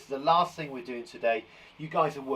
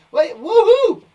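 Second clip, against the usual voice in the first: excited woohoo; 14 dB louder, slower than usual. Acted.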